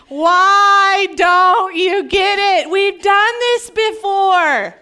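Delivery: fed-up, emphatic plea; exasperated. Acted. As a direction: stretch each word in exasperation. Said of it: "That's not how it played out".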